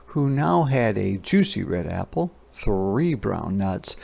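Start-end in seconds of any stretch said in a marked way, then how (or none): none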